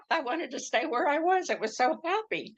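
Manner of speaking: high voice following a laugh; laugh, then keep the pitch high; laughing protest. Real, not acted. Laughs at own retold protest.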